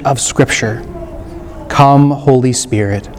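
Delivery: low, unhurried invocation with long pauses; prayer. Real, not acted. Priest leading listeners to invite the Holy Spirit, prayerful.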